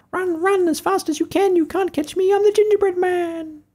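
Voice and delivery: very high, squeaky taunting chant; sing-song little cookie taunting chasers; gingerbread man voice. Acted.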